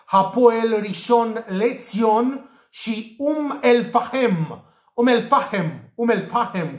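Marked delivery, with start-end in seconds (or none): 3.2-6.8 Umm El Fahem with a breathy h and long final "hem"; local pronunciation. Shown as a demonstration. Tipster shows viewers how locals say it, "Fahem" in two syllables, h sounded. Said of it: "cum pronunță ei acolo".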